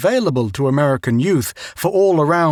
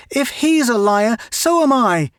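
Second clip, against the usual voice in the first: high, indignant cry of defence; a sister snapping back in his defence; Mary Ann defending her brother to their father, indignant; angry protest; 9 semitones higher than usual. A narrator's reading. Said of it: "Mary Ann gasped in angry protest".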